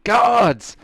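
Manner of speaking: high, loud calls for the guards; father calls the guards down on the stranger; shouting for guards. Acted. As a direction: yell Guards like an outraged father. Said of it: "he shouts out".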